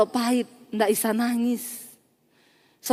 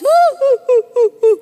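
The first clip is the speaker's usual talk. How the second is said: loud, high mock sobs; hammed-up boo-hoo wail in bursts; imitated sobbing; 12 semitones higher, 8 dB louder than usual. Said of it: "Makin nangis". (tiruan tangis meraung-raung)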